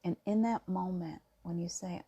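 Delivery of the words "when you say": usual speaking voice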